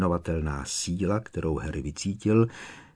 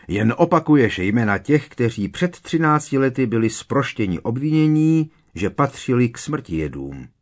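raised, loud, irate rebuke; angry; 5 semitones higher, 7 dB louder than usual. A narrator's reading. Minister dismissing the boy's list of names, angry. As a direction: cut him down with loud annoyance. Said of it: "řekl Popletal rozlobeně".

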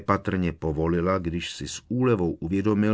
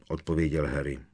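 ordinary delivery, nothing marked

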